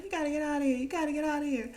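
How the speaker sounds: high, urgent repeated order; mock-yell as if shooing her out in a panic; imitated yelling. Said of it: "he keeps yelling at her to get out".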